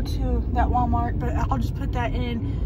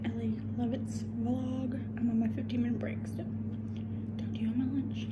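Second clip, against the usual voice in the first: hushed whisper on break; real whisper; 8 dB quieter than usual. Real, not acted. Whispering to viewers during her work break. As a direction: keep it whispered so coworkers don't hear.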